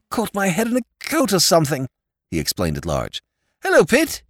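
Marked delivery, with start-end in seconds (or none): 0.1-1.9 pompous sputtering colonel. A narrator's reading. Flustered lord emerging from curtain. 3.6-4.2 pompous sputtering colonel. A narrator's reading. Flustered lord greets Jimmy.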